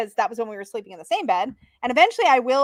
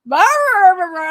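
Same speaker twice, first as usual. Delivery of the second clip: sleep groaning; 3 semitones higher, 8 dB louder, slower than usual. Retold or imitated. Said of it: "I'll be like".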